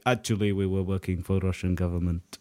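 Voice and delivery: deadpan soldier confession. Retold or imitated. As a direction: quietly admit it as a sheepish soldier. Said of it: "They'll be like".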